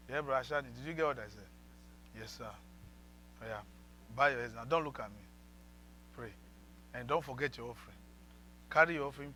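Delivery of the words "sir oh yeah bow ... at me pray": ordinary talk, nothing marked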